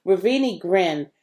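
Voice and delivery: plain reading voice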